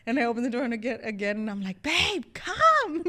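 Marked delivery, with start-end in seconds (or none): 1.8-3.0 much higher, loud, half-laughing call; stunned urgent call. Retold or imitated. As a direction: cry out for him in giddy shock. Calling boyfriend again, stunned.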